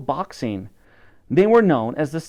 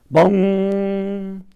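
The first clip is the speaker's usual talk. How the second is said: clock bong; 7 semitones higher than usual. A narrator's reading. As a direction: ring out like a big clock bell. Clock bell sound effect.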